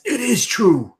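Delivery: high, loud desperate plea; wild-eyed insistence. Acted. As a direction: insist like a man unhinged. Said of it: "his eyes wild".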